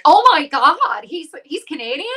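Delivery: playing a stunned fan reading the news; shocked fan. Host imitating a stunned fan, mock shock. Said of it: "people were like".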